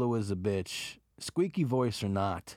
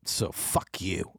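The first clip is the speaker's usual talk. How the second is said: hostile; 5 semitones lower, slower than usual. Real, not acted. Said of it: "I'm a little hostile".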